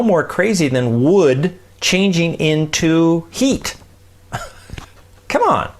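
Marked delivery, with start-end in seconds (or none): 4.3-5.7 high, amused come on through a laugh. Real, not acted. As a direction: laugh it off as obvious. Laughing aside after wood-to-heat comparison.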